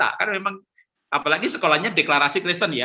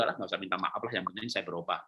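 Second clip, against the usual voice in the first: very quiet, low scoffing aside; dismissive husband; 10 semitones lower, 12 dB quieter, faster than usual. Retold or imitated. A logical husband refusing to apologise, scoffing quietly and low. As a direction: wave off the apology under your breath, lower.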